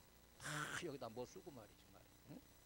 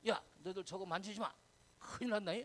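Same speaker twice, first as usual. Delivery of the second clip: lightly voiced parental warning, higher and louder; scolding parent; 5 semitones higher, 7 dB louder than usual. Mom and dad telling kids not to touch, mildly acted scolding. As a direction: warn the children off like a parent. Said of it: "엄마 아빠가".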